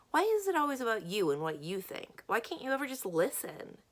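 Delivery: frustrated, accusing outburst; angry reproach. Acted. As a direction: lash out at a friend who never listens. Acted quarrel, friend fed up.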